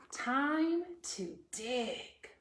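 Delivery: slow, drawn-out announcement; dramatic read. Acted. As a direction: drag it out like a big reveal. Reading page title dramatically.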